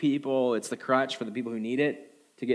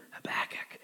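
quick breathy aside inside the quote; whispered aside; 11 dB quieter than usual. Real, not acted. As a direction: drop to a whisper to name the source. Whispered aside during scripture reading.

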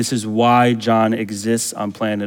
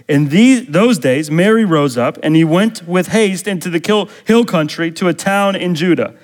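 higher, louder preacher's reading; emphatic scripture reading; 8 semitones higher, 5 dB louder than usual. Acted. Preacher reads prophecy of John to the church, higher and emphatic. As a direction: proclaim the verses with rising and falling force.

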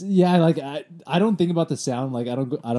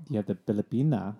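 lightly put-on Filipino accent; 3 semitones lower, 5 dB quieter than usual. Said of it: "That was a good accent".

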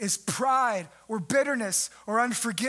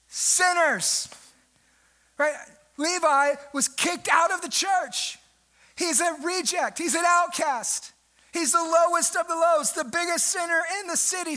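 raised, pressing preacher's voice; preaching at full force; 5 semitones higher than usual. Acted. Preacher rouses church about outcasts, full force. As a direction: drive it home to the congregation.

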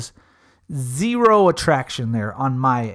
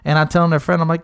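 ordinary talk

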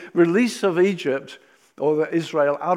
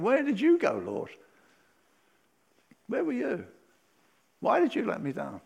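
plaintive complaint; 6 semitones higher than usual. Retold or imitated. Complaining believer voiced.

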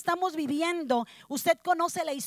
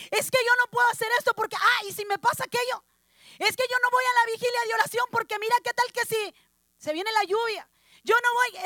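whiny excuse-maker; 6 semitones higher, 4 dB louder, faster than usual. Retold or imitated. Mocking fearful believer excuses.

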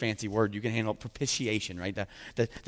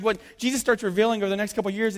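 ordinary delivery

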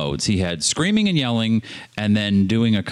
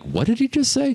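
confused; 13 semitones higher than usual. Retold or imitated. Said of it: "I was like".

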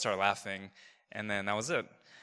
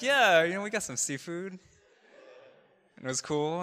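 high-pitched, fake-casual dodge; feigned casualness; 7 semitones higher than usual. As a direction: play it innocent in a higher voice. Acting casual to dodge confessing.

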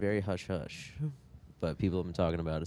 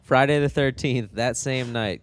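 excited; 6 semitones higher, 9 dB louder than usual. Real, not acted. Hyped plug announcement.

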